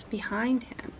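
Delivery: soft, hushed suspense. A narrator's reading. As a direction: drop to a hush, building suspense. Hushed suspense before monkey attack.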